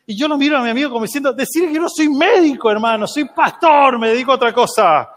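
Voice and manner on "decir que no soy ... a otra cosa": protesta muy aguda, actuada; protesta exasperada. Retold or imitated. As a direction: alega con desesperación cómica que no te toca. Pastor protesta mentalmente al amigo. (pastor exasperado, exagerado)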